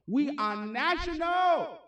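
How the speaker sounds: slow, drawn-out proud shout; hyped shout. Real, not acted. Host boasting to stream viewers, slow hyped shout. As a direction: call it out big and slow to hype the crowd. Hyping the livestream audience.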